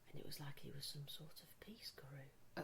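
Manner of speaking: whispered. Retold or imitated. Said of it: "Good bedside manner".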